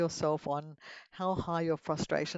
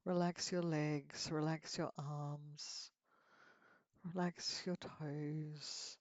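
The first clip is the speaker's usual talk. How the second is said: slow, soft relaxing murmur; soothing massage murmur; 9 dB quieter, slower than usual. Acted. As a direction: ease each body part, unhurried. Slow relaxation cues in meditation.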